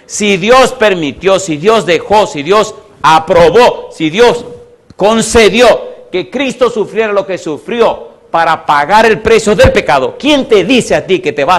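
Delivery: slow, high, building sermon rhythm; impassioned preaching. Real, not acted. Preacher building an argument on God's permission, impassioned. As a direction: hammer each clause out to the congregation.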